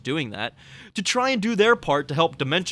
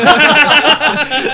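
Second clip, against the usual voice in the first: loud, high burst of laughter; big burst of laughter; 12 semitones higher, 13 dB louder than usual. Real, not acted. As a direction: crack up loudly at the joke. Huge laugh at shared joke.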